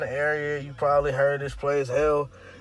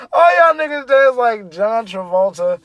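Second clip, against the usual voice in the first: louder, laughing through the punchline; laughing shout; 4 semitones higher, 6 dB louder than usual. Real, not acted. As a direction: shout it while cracking up. Repeated punchline while laughing.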